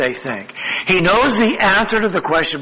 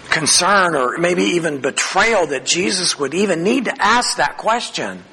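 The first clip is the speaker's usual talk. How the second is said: normal talking voice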